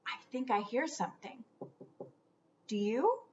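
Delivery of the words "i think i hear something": ordinary delivery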